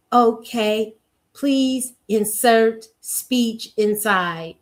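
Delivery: very slow mechanical robot voice. Retold or imitated. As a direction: drone it like a machine asking for input. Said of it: "they just wind you up".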